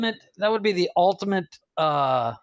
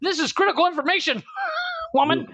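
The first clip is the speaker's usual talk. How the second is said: mock outraged shout; 10 semitones higher than usual. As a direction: demand the facts, hammed up.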